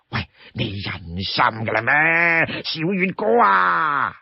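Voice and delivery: high, whining plea with stretched endings; pleading Li Kui. Acted. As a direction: beg and cajole your brother. Li Kui begs to come along.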